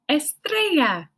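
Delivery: slow "Estrella" with rolled r and -eya ending; drawn out for the class to copy; modelled Spanish word. Shown as a demonstration. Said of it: "Can you say it with me".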